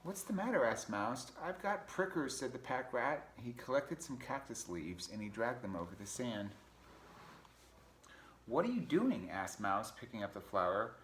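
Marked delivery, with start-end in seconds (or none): 0.0-0.6 high-pitched mouse question; concerned mouse. Acted. Mouse asks crying rat. 1.4-2.4 high, pained rat voice; complaining pack rat. Acted. 8.5-9.3 high, loud puzzled question; curious mouse. Acted. Mouse questions rat in story.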